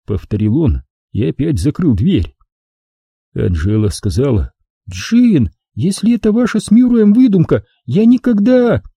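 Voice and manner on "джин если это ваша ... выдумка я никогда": higher, lighter woman's voice; young woman, uneasy; Angela's voice. Acted. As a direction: voice the worried girlfriend. Angela's voice in narration.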